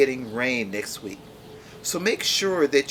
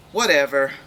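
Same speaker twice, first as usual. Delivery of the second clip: dismissive whatever; 6 dB louder than usual. Acted. Dismissive reply in argument.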